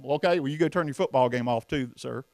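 usual speaking voice

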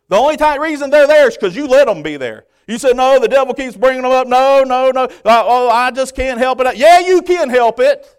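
very loud, high, insistent preaching; full-force preaching. Real, not acted. Preacher rebutting the church's excuses, full-force preaching.

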